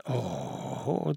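long drawn-out, menacing Oh; understated menace; threatening. A narrator's reading. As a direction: draw out the groan as a threat. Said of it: "drohte er und knirschte mit den Zähnen".

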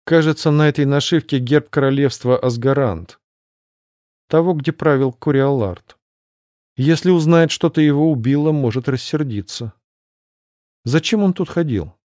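annoyed. Acted. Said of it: "проговорила с досадой".

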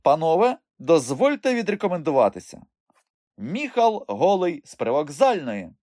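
high, grand announcement; theatrical self-introduction. A narrator's reading. Boy introducing himself to highlanders, theatrical. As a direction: present yourself grandly, slowing on the name.

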